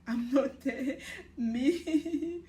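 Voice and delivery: giggling protest; laughing denial. Retold or imitated. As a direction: deny it while cracking up. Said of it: "It's like, it's like".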